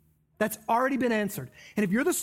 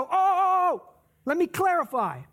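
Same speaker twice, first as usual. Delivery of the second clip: flustered, higher "oh, oh"; a hint of nervous backpedaling, higher; mock flustered Jesus; 6 semitones higher than usual. Preacher mocking what Jesus didn't say, flustered. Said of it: "Jesus didn't go".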